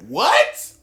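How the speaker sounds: sudden high what; incredulous yelp; surprised. Real, not acted. Shocked reaction to story.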